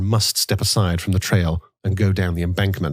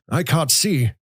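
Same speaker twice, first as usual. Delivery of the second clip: put-on old man muttering; 7 semitones higher than usual. A narrator's reading. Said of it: "he muttered".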